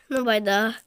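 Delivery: usual speaking voice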